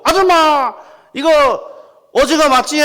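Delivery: high, loud appeal to a passerby; urgent market call. Acted. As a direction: call a stranger over to settle it. Asks passing woman to confirm.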